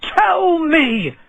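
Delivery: high angry yell, slow on the second line; furious shout. A narrator's reading. Bird seller shouting at the dwarf among his birds, furious. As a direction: bellow at the thief. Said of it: "he shouted".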